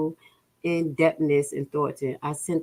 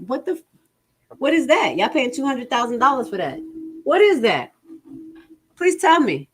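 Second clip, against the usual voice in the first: shocked disbelief; 8 semitones higher than usual. Real, not acted. Stunned at the budget line.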